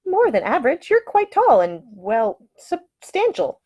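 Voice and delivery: high, prim lady voice, slowing on substantial; tactful but blunt young woman; lady picking her words about his size. Acted. Ida lectures Masterton about bunks.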